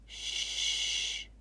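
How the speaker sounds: soft breathy shush; hushing. Acted. Mommy hushing Anisa in the masjid, shush. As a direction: hush the child quickly.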